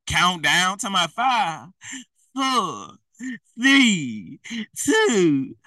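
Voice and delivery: slow, spaced-out countdown; mock New Year countdown. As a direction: count down slowly, leaving gaps. Mimics New Year's countdown.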